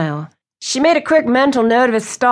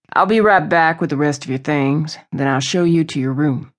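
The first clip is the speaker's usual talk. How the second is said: lower, even host's voice; low-voiced host; 4 semitones lower than usual. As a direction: keep the voice low and matter-of-fact.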